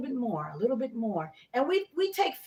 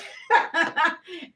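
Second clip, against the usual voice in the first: a real burst of laughter; 5 semitones higher than usual. Real, not acted. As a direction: genuine amused laughter. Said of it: "I'm laughing".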